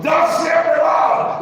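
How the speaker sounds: high, full-force drawn-out shout; prophetic proclamation. Acted. Preacher plays a national prophet before everybody, shouted proclamation.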